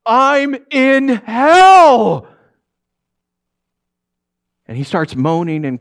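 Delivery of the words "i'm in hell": slow, high, loud wail; tormented ghost screaming out; anguished scream. Acted. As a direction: scream it with long stretched words. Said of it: "Tony screams at him".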